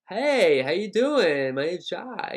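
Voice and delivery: big, open, loud American greeting; a loud, hammed-up American hello. Shown as a demonstration. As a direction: throw it out with a wide-open mouth. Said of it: "it's very open".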